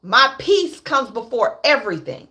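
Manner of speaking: usual speaking voice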